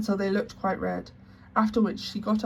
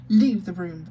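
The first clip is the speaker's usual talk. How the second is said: much louder curt command; stern order; 7 dB louder than usual. Acted. Headmistress orders the weeping maid out, loud and stern. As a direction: order her out coldly. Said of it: "ordered Miss Minchin".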